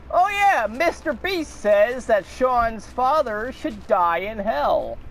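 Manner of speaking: high, slow, mocking scammer voice; mock spam voice. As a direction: sneer out the fake claim.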